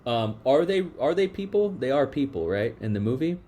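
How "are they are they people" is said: normal talking voice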